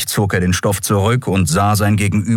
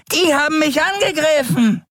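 bizarre skeleton voice. Acted. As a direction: announce it like a grinning ghoul pointing a finger. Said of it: "verkündete seine bizarre Stimme".

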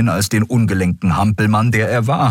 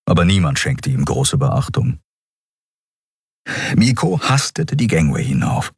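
ordinary delivery, nothing marked

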